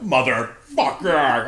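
slow, loud, drawn-out curse; exasperated loud groan of a curse; frustrated curse. Retold or imitated. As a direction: strain it out in frustration. Climbing over the console.

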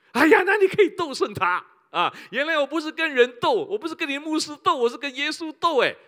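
high, loud exclaiming preacher; impassioned sermon outcry; full-force preaching. Acted. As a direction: cry out the realization to the crowd. Preacher's outcry about fighting God.

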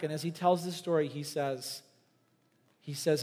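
ordinary talk, nothing marked

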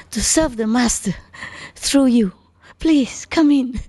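high, eager voice of a delighted host; gushing disciple. Retold or imitated. Disciple welcoming the master's visitor, gushing high voice. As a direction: welcome the guest as if it's the honour of your life. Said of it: "ah, he say".

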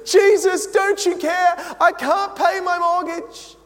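high, loud, frantic complaining to Jesus; loud mock-desperate wail; whiny panicked plea. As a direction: beg like a worried believer, hammed up. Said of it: "I can just see myself doing it".

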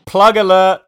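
high, loud jingle shout; plug alert callout. Acted. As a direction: announce it like a siren.

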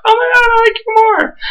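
breathless shrill fangirl gush; fan squeal. Pretend. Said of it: "I'm just like".

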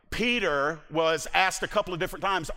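ordinary talk, nothing marked

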